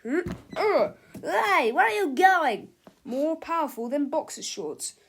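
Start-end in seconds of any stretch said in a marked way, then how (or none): 0.0-2.7 high, loud panicked shout; frightened cry. Acted. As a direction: yell out in alarm at the robot. Bystanders cry out at robot.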